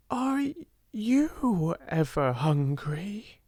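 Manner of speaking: halting, high, slowing at the end; mild timid hesitation; timid, awe-stricken. A narrator's reading. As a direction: stammer it out, afraid to ask. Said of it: "in a timid, almost awe-stricken voice".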